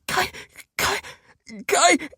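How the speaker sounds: high, gasped out without breath; breathless gasping. Acted. Prisoner gasping her name with no breath left. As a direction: fight for air to say her name. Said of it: "tried to find the breath".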